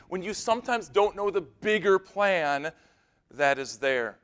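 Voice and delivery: usual speaking voice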